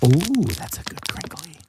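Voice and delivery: very soft, mostly whispered; hushed ASMR voice. Pretend. Host praises a crinkly sound for ASMR, hushed. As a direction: play the soothing ASMR host, barely audible.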